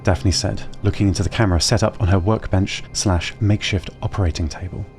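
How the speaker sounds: ordinary delivery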